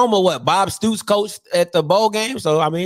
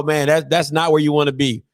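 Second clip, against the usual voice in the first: ordinary talk, nothing marked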